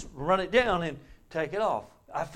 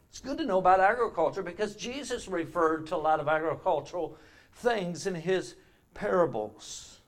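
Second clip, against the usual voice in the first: ordinary delivery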